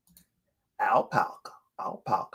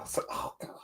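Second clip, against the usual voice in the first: whisper; 15 dB quieter, faster than usual. Real, not acted. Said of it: "whispered aside".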